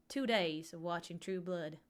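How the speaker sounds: playful put-on Louisiana accent. Shown as a demonstration. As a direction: slip further into a Louisiana accent. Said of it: "try to do a Louisiana accent".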